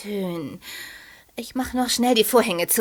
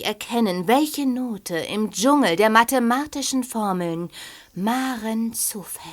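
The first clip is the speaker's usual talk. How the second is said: mystical incantation. Acted. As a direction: call on the ball like a seer. Fortune-teller invokes the crystal ball.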